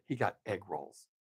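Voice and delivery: a hushed, low whisper into her ear; whispered aside. Retold or imitated. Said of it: "whispered in her ear".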